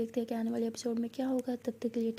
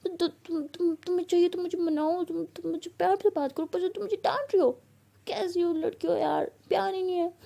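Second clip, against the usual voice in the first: hurt, pouty outburst in a raised pitch; sulky complaint; 6 semitones higher than usual. A narrator's reading. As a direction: whine indignantly like someone wanting to be coaxed. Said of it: "ऐसे मुँह फुला के बैठ जाती है".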